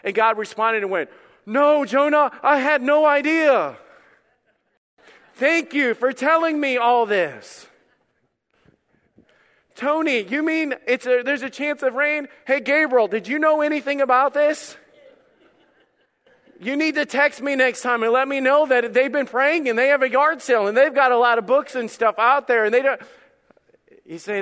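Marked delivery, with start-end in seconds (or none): 1.5-7.7 high, loud, slow mock-surprised sarcasm; sarcastic God. God mocking Jonah sarcastically. 9.8-23.0 high, loud, laughing mock surprise; mock-surprised God. God mocking Tony with laughing sarcasm.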